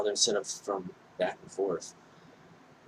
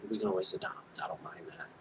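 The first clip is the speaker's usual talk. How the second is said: hushed, breathy private thought; whispered thought; 8 dB quieter than usual. Retold or imitated. Man reassuring himself about his date, whispered. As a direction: whisper it like talking to yourself.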